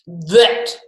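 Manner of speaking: a hint of a looming villain; menacing voice. Sir Gargoyle interrupts the pets, mildly menacing. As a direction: say it like a threat from the shadows. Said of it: "said a voice".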